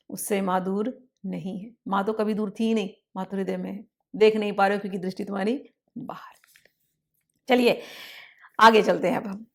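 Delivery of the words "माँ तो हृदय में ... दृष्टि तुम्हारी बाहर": quick, hushed whisper; whispered confiding aside. Real, not acted. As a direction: whisper it like a secret. Intimate spiritual point.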